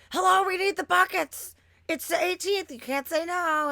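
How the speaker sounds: wysoko i głośno, podekscytowane żądanie; pushy excited demand. Retold or imitated. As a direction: demand the buckets in a high, loud, excited voice. Demanding buckets at the drive-thru.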